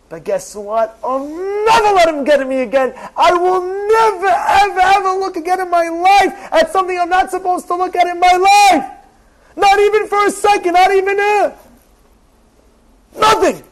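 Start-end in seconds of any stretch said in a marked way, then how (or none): 3.2-13.6 fervent vow. Acted. Vowing never to look again.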